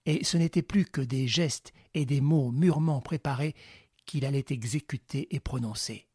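ordinary delivery

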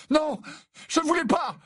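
high, distraught outcry; horrified protest. A narrator's reading. Harry over the bleeding boy, distraught. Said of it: "Harry ne savait plus ce qu'il disait".

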